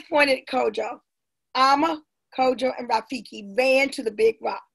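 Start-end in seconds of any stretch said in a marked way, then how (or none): none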